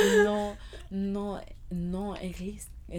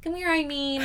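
cooing at a pet; 10 semitones higher than usual. Pretend. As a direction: call the kitty sweetly. Calling pretend cat.

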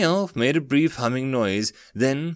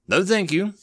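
mildly icy refusal, pitched up; 8 semitones higher than usual. A narrator's reading. Daring turning down the elf's offer, with a touch of ice. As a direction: decline with cool politeness. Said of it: "said Daring icily".

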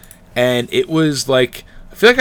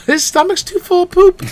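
squeaky high voice; 4 dB louder than usual. Pretend. Voicing dog, squeaky.

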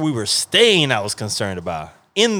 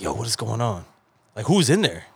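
put-on low, soft retelling of nervous kids; hushed wondering; 7 semitones lower, 5 dB quieter than usual. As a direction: quote it softly, as if listening in the dark. Kids hear noise in dark room.

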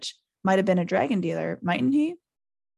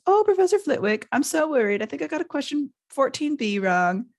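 high, mock-worried mimicry; exaggerated squeaky worrier; put-on high voice; 7 semitones higher than usual. A narrator's reading. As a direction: tease with a fretful swot impression. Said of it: "He put on a high voice".

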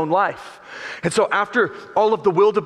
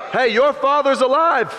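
high excited Rafiki; 8 semitones higher, 4 dB louder than usual. Retold or imitated. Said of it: "Rafiki says to Simba".

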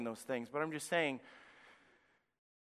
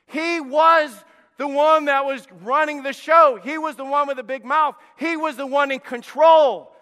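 full-force preaching; 10 semitones higher, 12 dB louder than usual. Acted. Preacher to his congregation, loud full-force preaching. Preacher hammering Goliath's power.

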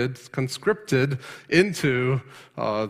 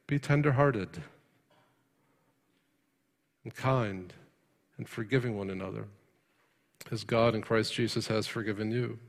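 much softer, lower and gentle; tender scripture reading; 3 semitones lower, 7 dB quieter than usual. Acted. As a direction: read it like a comfort.

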